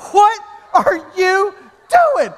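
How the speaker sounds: slow, high, drawn-out mock disbelief; incredulous wife. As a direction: stretch it out in acted disbelief. Wife confronts him, acted out.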